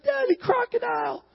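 put-on very high, small child's wail; imitated crying child. Preacher mimics son alerting Daddy, crying. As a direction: wail it like a frightened little boy. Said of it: "he's crying".

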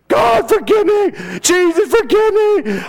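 high-pitched mock-panicked scream. Preacher recalls running down the street as a kid, screaming. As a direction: reenact a child's desperate yelled plea.

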